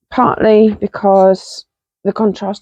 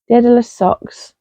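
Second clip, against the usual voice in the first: ordinary talk, nothing marked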